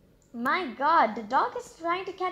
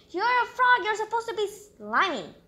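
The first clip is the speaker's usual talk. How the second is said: surprised; 9 semitones higher, 4 dB louder than usual. Real, not acted. Mock outrage at clean frog.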